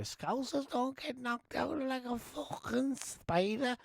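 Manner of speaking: high, slow attempt at a Liverpool accent; a self-confessed bad Scouse impression, stretched out. Fighter's post-fight boast, in a shaky Scouse accent. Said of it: "that's what he said".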